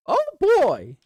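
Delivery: very high, loud squeaky cartoon mouse; Mickey Mouse voice. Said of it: "you don't enjoy Mickey Mouse's voice?".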